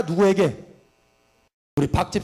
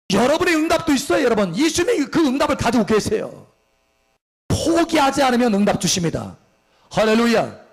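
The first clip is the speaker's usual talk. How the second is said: rousing revival preaching; impassioned preaching; 4 semitones higher than usual. Acted. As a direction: build them up with fervor. Preaching at full force.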